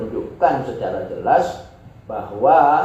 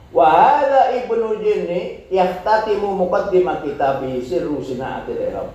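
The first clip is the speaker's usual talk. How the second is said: raised, louder recitation of the Arabic; Arabic text reading; 6 semitones higher, 3 dB louder than usual. Acted. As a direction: read the Arabic passage out formally. Reads Arabic text aloud.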